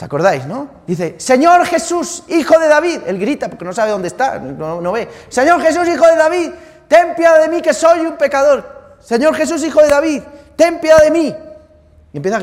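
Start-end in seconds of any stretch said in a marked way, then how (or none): 1.2-3.0 high, loud, slow desperate cry. Acted. Blind beggar shouting to Jesus passing by, desperate loud plea. 5.3-11.3 crying out. Acted. Blind beggar shouting to Jesus passing by, desperate loud plea.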